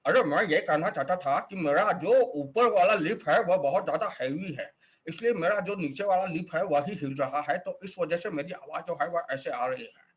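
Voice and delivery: character with an immobile upper lip; heavy upper lip. Shown as a demonstration. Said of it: "कुछ-कुछ लोग ऐसे बात करते हैं".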